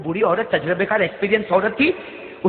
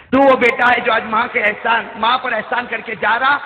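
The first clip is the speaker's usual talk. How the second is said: projected praise; 4 semitones higher, 6 dB louder than usual. Acted. Preacher repeating the mother's praise to listeners, projected. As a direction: praise declaimed out firmly.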